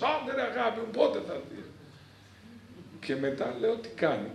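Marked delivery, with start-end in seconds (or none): 0.0-1.6 calling out loud and higher, pleading; mock call to a lover across the room; retold loud call; shouting. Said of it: "φωνάζει κιόλας".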